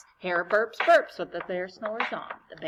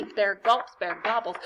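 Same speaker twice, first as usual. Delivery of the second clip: plain reading voice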